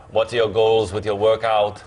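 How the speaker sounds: slow imitation of the bodybuilder's accented voice; Arnold Schwarzenegger impression. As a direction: imitate a famous bodybuilder, drawn-out words. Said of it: "Arnold Schwarzenegger happened to be taking".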